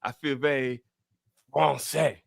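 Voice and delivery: hammed-up French accent; lilting mock-French questions and musing. Host feeling French in the air, mock French accent. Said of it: "do the show in a French accent".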